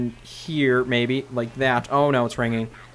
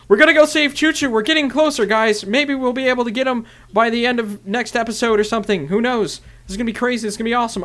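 excited; 7 semitones higher than usual. Real, not acted. Hyping the rescue mission.

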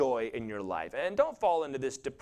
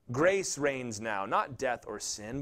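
ordinary talk

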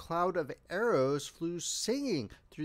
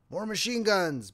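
mild yell; 5 semitones higher, 4 dB louder than usual. A narrator's reading. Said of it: "Bill yelled to Tom".

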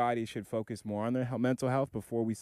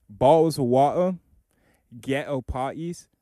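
English accent, loud start then easing to normal volume; mock English accent with pauses between items; put-on English accent; 8 dB louder than usual. Shown as a demonstration. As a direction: show off a borrowed English accent bit, phrase by phrase. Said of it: "all I can do in that accent".

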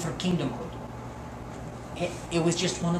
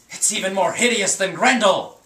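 ordinary talk, nothing marked

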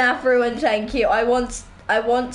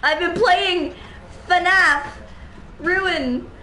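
higher, rattled after a jump scare; scared horror game streamer; 5 semitones higher than usual. Real, not acted. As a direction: shaken gamer, voice jumping up.

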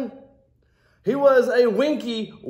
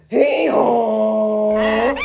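loud braying hee-haw like a donkey; 5 dB louder than usual. Acted. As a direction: bray it out like a donkey. Donkey bray in read-aloud.

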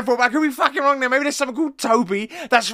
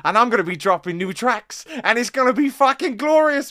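usual speaking voice

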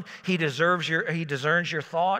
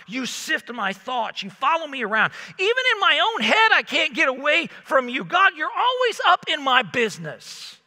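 higher, louder griping at God; fed-up whining, rising in pitch; exasperated complaint; 6 semitones higher, 3 dB louder than usual. Retold or imitated. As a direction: vent frustration at being watched. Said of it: "that's how he felt about God".

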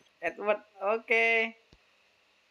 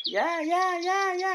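excited cheering; 12 semitones higher, 4 dB louder than usual. Real, not acted. Cheering the train on.

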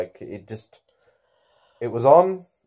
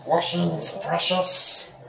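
higher hissing Gollum imitation; 7 semitones higher, much slower than usual. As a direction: croak it like Gollum. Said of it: "All right, Gollum".

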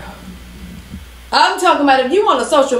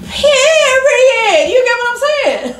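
real crying; 12 semitones higher, much slower than usual. Real, not acted. Father near tears over his son.

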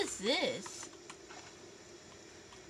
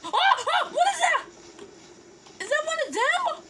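high, loud, laughing shriek; high excited yell with laughter; startled shout; 12 semitones higher, 6 dB louder than usual. Real, not acted. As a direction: yelp in a laughing fright. Startled by a moving enemy.

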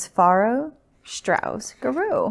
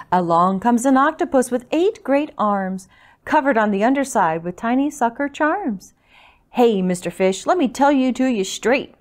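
lively, louder picture-book reading; story narration; 2 semitones higher, 4 dB louder than usual. A narrator's reading. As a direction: read it out rhythmically to little listeners. Reads picture book to children.

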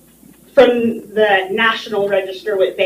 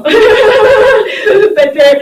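laughing; 10 semitones higher, 8 dB louder than usual. Real, not acted. Flustered looking for map.

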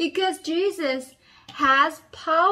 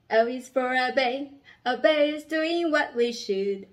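usual speaking voice

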